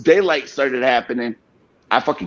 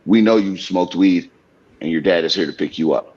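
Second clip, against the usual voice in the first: lower stern official; 9 semitones lower than usual. Retold or imitated. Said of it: "they're like".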